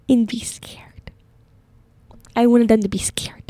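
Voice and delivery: mock-sinister whisper; hushed confession. Student confessing to her roommate, hushed mock-sinister whisper. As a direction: drop into a whispered admission.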